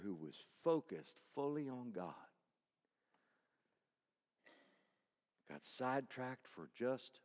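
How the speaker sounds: soft suspenseful retelling; hushed sermon storytelling. Acted. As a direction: pull them in close and quiet.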